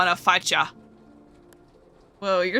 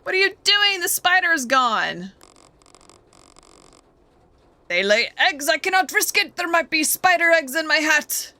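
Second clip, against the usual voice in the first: mock panic; 7 semitones higher than usual. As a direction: squeal it like a bug in the hat. Panicky character voice.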